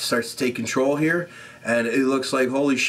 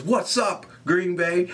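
a higher, hyped mock greeting to the crowd; a hint of R-Truth hyping up the Green Bay crowd; imitating R-Truth; 5 semitones higher, slower than usual. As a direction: lightly call out to the crowd like R-Truth. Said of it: "R-Truth says".

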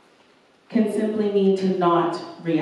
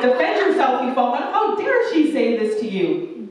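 indignant inner voice; 5 semitones higher than usual. Retold or imitated. Her flesh urging her to fight back, higher and indignant. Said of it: "My flesh was like".

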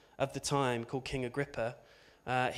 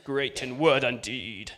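slow, louder, mock-grand voice; grand pronouncement; 6 dB louder, slower than usual. Shown as a demonstration. Preacher mock-proclaiming the obituary, slow and grand. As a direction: clear your throat and proclaim it. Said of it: "Um, clears throat".

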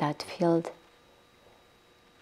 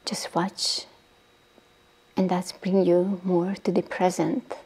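guided meditation. Acted. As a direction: ease the listeners down into stillness, breathy and unhurried. Leads a guided body-relaxation meditation.

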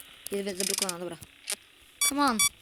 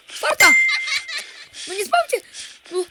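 tense shout; 10 dB louder than usual. Real, not acted. Reaching 4 AM, stressed.

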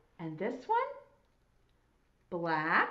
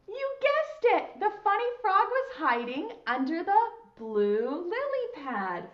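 high, delighted announcement for preschoolers; excited congratulation; 5 semitones higher than usual. Pretend. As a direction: celebrate the child's right guess. Celebrates children finding the frog.

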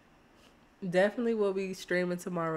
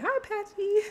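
high cutesy greeting; 13 semitones higher than usual. Retold or imitated. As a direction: chirp hi to him like a smitten girl. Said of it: "Be like".

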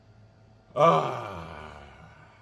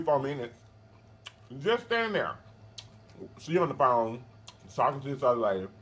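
talking with food in mouth; chewing while talking. Real, not acted. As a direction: between bites. Seen 4: Eats throughout: fork at -3.0, pickle into mouth at +5.8.

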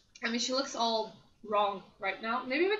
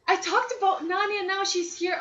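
excited surprise; 6 semitones higher, 7 dB louder than usual. Real, not acted. Nani appears just after mentioned.

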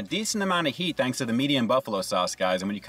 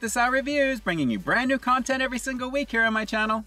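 bright, higher channel outro, announcer-like; upbeat sign-off; 8 semitones higher than usual. Acted. Host signing off his review channel to viewers, upbeat announcer delivery.

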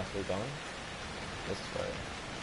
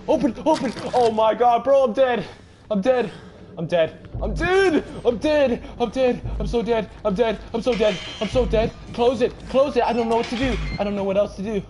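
high, loud frantic yelling; real panic, repeating in high bursts; 11 semitones higher, 6 dB louder than usual. Real, not acted. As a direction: cry out as if cornered and doomed. Cornered and about to die in game.

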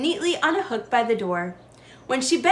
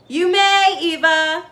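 loud, higher call back; called from the kitchen; 6 semitones higher, 6 dB louder, slower than usual. A narrator's reading. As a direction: answer from another room. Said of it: "her grandfather called from the kitchen".

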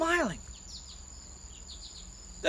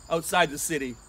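usual speaking voice

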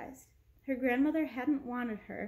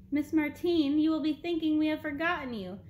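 booming voice; 5 semitones higher, 5 dB louder than usual. A narrator's reading. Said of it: "boomed a voice so deep".

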